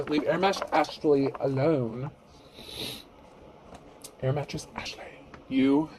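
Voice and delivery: put-on bratty teen talking back to a parent; snotty teenager voice. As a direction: sneer it like a spoiled teenage girl. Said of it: "that's also my snotty teenager voice".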